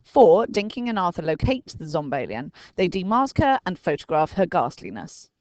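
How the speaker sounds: ordinary delivery, nothing marked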